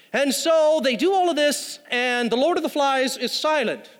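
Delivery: normal talking voice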